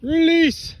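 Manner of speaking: high, loud call at the ball; excited shout. Real, not acted. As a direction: yell it on, pleading with the ball. Urging the ball on.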